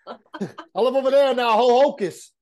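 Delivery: loud, high mock New Jersey accent; hammed-up New Jersey talk, half laughing; imitating local New Jersey accent. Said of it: "'Cause that's how they talk".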